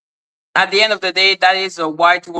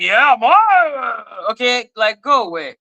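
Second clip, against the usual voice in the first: higher, drawn-out playful call; joking catchphrase; 4 semitones higher, slower than usual. Retold or imitated. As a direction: stretch it out like a hype shout. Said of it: "me being like".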